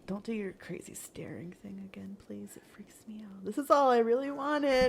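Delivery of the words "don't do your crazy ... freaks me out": whispered plea; nervous whisper. Acted. Natsuki whispering a nervous plea to Yuri. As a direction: breathe it so she won't hear.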